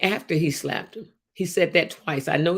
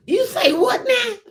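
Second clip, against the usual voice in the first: much higher, slow mock disbelief; incredulous; 5 dB louder, slower than usual. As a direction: throw the question back in disbelief. Challenging Willie Lynch's letter.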